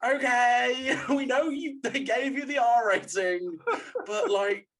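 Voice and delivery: high, loud, drawn-out sarcastic okay; exasperated mockery. Viewer answering forced R rating, loud mock exasperation. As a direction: humour them loudly, exasperated. Mocking the film's edgy ending.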